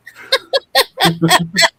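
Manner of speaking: high squealing giggle. Real, not acted. Cohost laughing about AI taking over her thumbnails, high giggle. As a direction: let a high giggle burst out.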